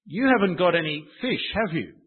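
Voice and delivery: loud, casual call to the lads expecting a no; a stranger calling out to the lads that they've caught nothing; matey negative question. Shown as a demonstration. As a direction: rib the fishermen like mates on the beach. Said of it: "It's sort of like".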